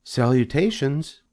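high, thin spider greeting. A narrator's reading. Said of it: "he heard again the thin voice".

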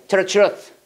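"Tell the truth" run together quickly as 터러츄러스; fast linked reading. Shown as a demonstration. Teacher demonstrating quick linked "Tell the truth", reduced middle sound. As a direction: slur "Tell the truth" into one quick unit. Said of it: "진짜 빨리 읽어".

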